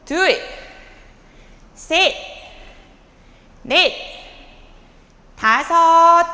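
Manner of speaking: loud coach counting out reps; barking gym coach. Shown as a demonstration. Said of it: "아까 반템포 빠르게 가요".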